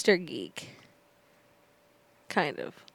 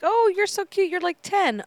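cooing at him; 9 semitones higher, 5 dB louder than usual. Retold or imitated. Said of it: "I was like".

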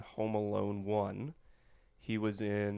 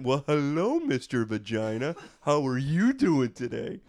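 silly greeting voice; 3 semitones higher, 5 dB louder than usual. Retold or imitated. Comic greets his date's crotch in a hammed-up voice. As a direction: drawl it like a goofy gentleman greeting someone. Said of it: "I leaned down to her crotch and I went".

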